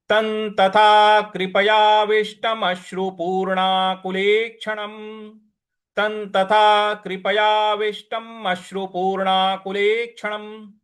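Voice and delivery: slow, measured Sanskrit recitation. Shown as a demonstration. Teacher recites the verse slowly for students to follow. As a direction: chant the Sanskrit line slowly and evenly. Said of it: "इस प्रकार से इसको बोला जाएगा".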